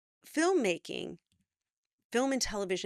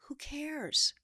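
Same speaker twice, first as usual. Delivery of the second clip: breathy whisper; 8 semitones higher than usual. Real, not acted. Coach dismisses worry, mock aside.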